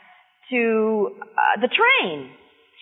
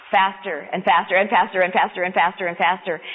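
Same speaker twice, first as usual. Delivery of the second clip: lower, quickening repetition; gathering speed; 5 semitones lower than usual. A narrator's reading. Storyteller on poles passing train window, speeding up. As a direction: build pace like passing poles.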